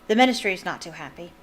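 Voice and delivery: quick, light attempt at an Irish accent; brisk, faintly Irish aside; a hint of an Irish accent, quick and sly; terrible Irish accent. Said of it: "my terrible Irish accent".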